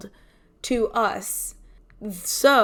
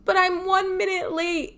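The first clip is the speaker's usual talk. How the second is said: high, whiny protest; whiny plea; 10 semitones higher, 3 dB louder than usual. Retold or imitated. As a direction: whine at the gate agent. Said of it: "I was like".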